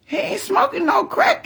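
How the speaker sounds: squeaky indignant granny voice; mock grandma protesting, high and loud; imitating grandma. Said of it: "She said".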